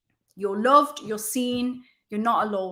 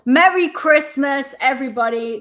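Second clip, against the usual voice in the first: festive greeting; 4 semitones higher, 8 dB louder than usual. Real, not acted. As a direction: belt out the greeting with the hat on. Hat on, greets viewers.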